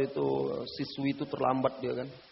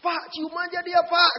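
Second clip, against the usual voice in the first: much higher, slow cheeky yell; student's yell; 7 dB louder, slower than usual. Retold or imitated. Teacher retelling a cheeky student yelling a dare, high and slow. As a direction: shout the dare across the class. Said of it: "langsung dia teriak".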